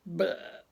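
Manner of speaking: a spoken belch noise; mock burp. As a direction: say it like a meaty belch. Imitated belch.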